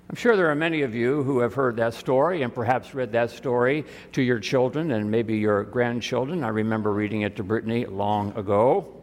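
usual speaking voice

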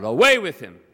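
high, loud crowd cry. A narrator's reading. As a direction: call out as an angry mob. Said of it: "And they cried out".

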